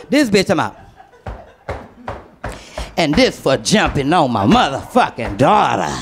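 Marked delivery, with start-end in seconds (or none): 3.0-5.9 much lower, menacing voice; a hint of a mean neighbor; Maycat's threat. Neighbor stomping on the portfolio, low and menacing.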